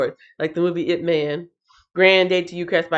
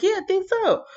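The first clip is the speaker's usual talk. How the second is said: mock quote; 13 semitones higher than usual. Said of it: "I was like".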